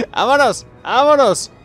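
gleeful hurry. Real, not acted. Hurrying off with captive.

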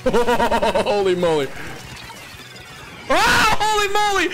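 loud, high, stretched-out cheer; excited shout. Real, not acted. Celebrating a win in game.

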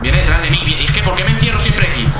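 loud, high-pitched frustration; real alarmed, exasperated yelling; panicked shout. Real, not acted. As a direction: shout it while cornered and chased. Cornered by the pursuing monster.

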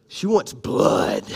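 slow, half-whispered menace; menacing relish. Acted. Preacher telling what scorned Tamar wants, slow menacing hush. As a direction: draw it out like a breathy threat.

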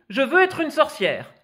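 put-on high, loud, insistent child's wish; eager declaration. Girl to her mother, loud, high and insistent.

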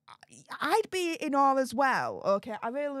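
normal talking voice

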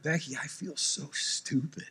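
sheepish muttered admission; sheepish confession. Retold or imitated. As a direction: confess to wife in the car, quietly. Embarrassed at the car.

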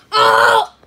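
loud burning reaction; roast shout. Real, not acted. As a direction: yell Oh like a crowd.

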